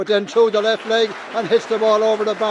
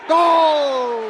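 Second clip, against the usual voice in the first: long goal yell; 9 semitones higher than usual. Real, not acted. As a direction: roar the goal call. Goal scored in live commentary.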